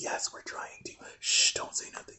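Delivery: unvoiced hushed reassurance; secretive whisper, shh. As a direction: hush him so the others stay asleep. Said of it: "They're like".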